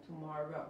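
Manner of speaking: TOMORROW with an "ah" in the middle, "too-MAH-row". Shown as a demonstration. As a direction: quietly model TOMORROW with an open A. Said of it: "esa A, ok, A, tomorrow, se pronuncia como".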